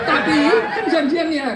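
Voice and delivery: laughing. Real, not acted. Preacher laughs mid-joke.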